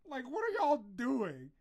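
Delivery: high, slow, startled demand; mock confusion at a voice from nowhere; a hammed-up who said that; retold shocked outburst. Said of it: "I was like".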